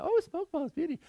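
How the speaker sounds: sing-song mom tease; imitating mom. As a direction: mom teasing you by the smoky campfire, lightly voiced. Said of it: "My mom's always good at".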